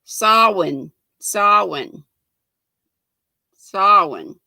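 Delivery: "Samhain" said as sow-en; "Samhain" practised like sah-win, falling; "Samhain" pronounced sow-in, repeated carefully; saying Samhain. Shown as a demonstration. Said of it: "I just looked up how to say it".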